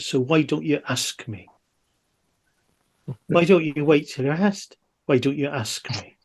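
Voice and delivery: put-on gentle, lilting Welsh accent on Bond's line; soft Welsh accent. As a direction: quote Bond softly in a Welsh accent. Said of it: "that's a lovely Welsh accent there".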